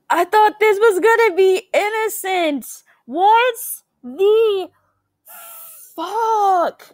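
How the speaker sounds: very high, loud wail; real shrieked disbelief, words pulled apart; distressed outburst. Real, not acted. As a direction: cry out each word in shock. Outburst after scares in game.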